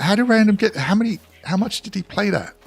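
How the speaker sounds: high-pitched, incredulous retelling; amazed reaction. Retold or imitated. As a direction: act out astonishment, voice jumping up high. Quotes own amazed reaction to friend.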